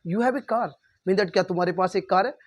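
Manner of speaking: ordinary delivery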